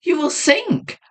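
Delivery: high, horrified call; horrified cry. A narrator's reading. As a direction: cry out in horror. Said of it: "I cried, sick with horror".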